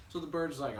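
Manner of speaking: ordinary delivery, nothing marked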